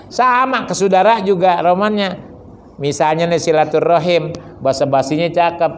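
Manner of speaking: ordinary delivery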